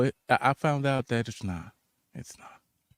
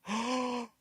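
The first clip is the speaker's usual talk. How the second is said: gasp, then high-pitched hurt; hammed-up crestfallen reaction; mock dismay; 8 semitones higher, slower than usual. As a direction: act out a wounded gasp. Retelling his hurt reaction to scorn.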